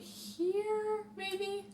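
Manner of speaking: high lilting "maybe?"; high questioning. Real, not acted. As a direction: toss it up high, unsure. Repeated maybe.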